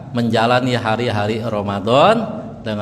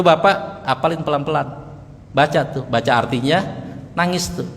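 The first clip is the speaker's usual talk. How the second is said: ordinary talk, nothing marked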